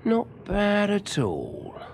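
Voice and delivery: very slow, drawn-out approval; slow, impressed. A narrator's reading. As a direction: let it out slowly, weighing the boy up. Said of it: "said the man slowly".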